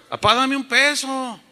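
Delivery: high-pitched blunt demand; demanding child. Retold or imitated. Boy asking his dad for a peso, high and demanding. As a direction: demand money like an entitled kid. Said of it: "yo le pedía mal".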